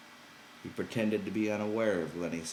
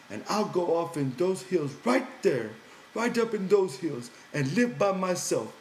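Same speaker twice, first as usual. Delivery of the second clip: Lenny pouting, higher and louder; high, sulky simple man; 12 semitones higher, 7 dB louder than usual. Acted. Lenny threatening to go live in a cave, high and sulky. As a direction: push it up high with a hurt edge.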